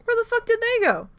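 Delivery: high, loud exasperated yell; exasperated outburst. Real, not acted. Angry at people who left, loud outburst. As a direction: shout it out in disbelief.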